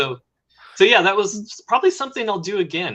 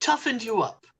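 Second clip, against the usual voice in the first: dry, higher mock macho; mock tough-guy voice; 6 semitones higher, 7 dB quieter than usual. Shown as a demonstration. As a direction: say it like a proud drill sergeant. Ironic repeat, far, +6.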